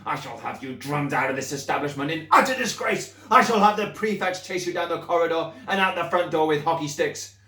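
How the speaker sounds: furious headmistress rant. Acted. Headmistress threatening the pupil with punishments.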